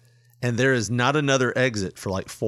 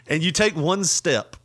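ordinary talk

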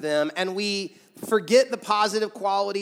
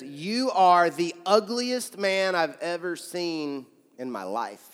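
deliberate parrot insult; slower than usual. Acted. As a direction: stretch it out as the joke's parrot, then sink. Parrot repeats insult in joke.

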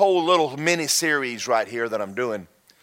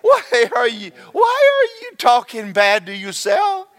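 self-scolding; 5 semitones higher, 3 dB louder than usual. Acted. Scolding himself for self-talk.

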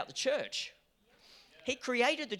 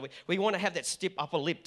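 normal talking voice

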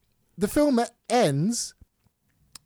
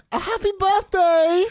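high, loud, drawn-out birthday shout; belted-out imitation of a friend's birthday greeting; 12 semitones higher, 5 dB louder, slower than usual. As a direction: call it out across a room.

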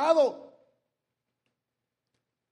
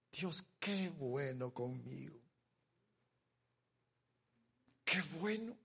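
soft, much quieter grateful prayer; tearful prayer, quiet; 5 semitones lower, 11 dB quieter than usual. Retold or imitated. Preacher retells his tearful prayer, very soft. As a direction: murmur thanks to God, near tears. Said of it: "me pongo a llorar y le digo".

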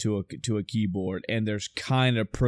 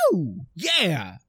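celebratory whoop; 6 semitones higher than usual. Retold or imitated. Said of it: "and goes".

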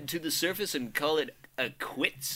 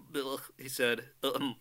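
usual speaking voice

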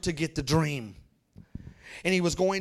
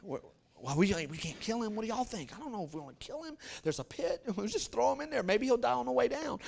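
hushed plotting; 3 semitones higher, 7 dB quieter, faster than usual. Acted. Brothers plotting in hushed tones.

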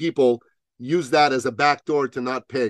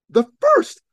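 astonished; 6 dB louder than usual. Real, not acted. Marvelling at being first.

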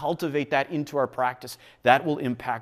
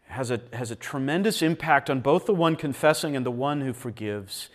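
ordinary talk

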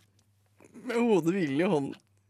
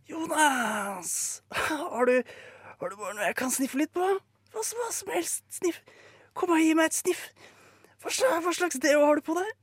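hes, manisk stemme; 10 semitones higher than usual. Acted. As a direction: rasp it high like someone desperate for a sniff. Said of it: "Stemmen hennes er hes". (hes, lys og desperat tigging)

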